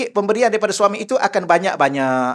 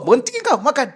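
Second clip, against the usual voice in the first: angry sheikh; 7 semitones higher than usual. Retold or imitated. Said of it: "Syekh marah sungguh".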